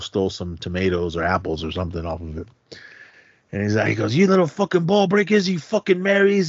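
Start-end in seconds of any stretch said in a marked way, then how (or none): 4.2-6.5 put-on high-pitched cursing at the kids; angry vendor. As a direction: imitate a riled-up street vendor. Vendor insulting kids who taunt him.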